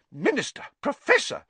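high, indignant summons; angry. A narrator's reading. Nurse confronting Minister and professor, angry. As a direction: call both men to order angrily. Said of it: "said Madam Pumphrey angrily".

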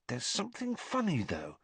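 thinking aloud, half to herself; puzzled Hermione. A narrator's reading. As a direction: Hermione wondering, soft and high.

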